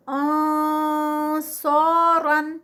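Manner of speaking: آنصارن slow with a full heavy nasal before ص; heavy ikhfa recitation. Shown as a demonstration. Teacher shows heavy ikhfa before the letter saad. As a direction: recite آنصارن slowly and heavily. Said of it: "اخفا ہے اور heavy ہے".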